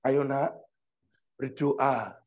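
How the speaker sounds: low, soft coaxing voice; God waking someone. Acted. God waking a child to pray, low gentle coaxing. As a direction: coax gently, low and hushed. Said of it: "kalau dibangunkan Tuhan jam satu".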